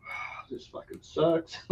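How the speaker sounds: slow, breathy whispered complaint; whispered groan. Retold or imitated. Sitting in the waiting room in pain, whispered and slow. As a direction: mutter it under your breath in pain. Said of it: "sitting there going".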